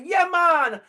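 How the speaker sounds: a Jamaican greeting, loud and high; Jamaican accent. Retold or imitated. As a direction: toss it off like a Jamaican. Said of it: "I play somebody from Jamaica".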